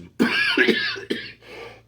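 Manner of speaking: a forced, put-on cough; theatrical cough; fake cough. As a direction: clear the throat for show. Mock cough before a punchline.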